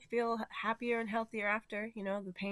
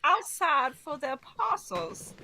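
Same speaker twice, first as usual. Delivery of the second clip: put-on Southern belle accent; 3 semitones higher, 6 dB louder than usual. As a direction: drawl it like a Southern lady.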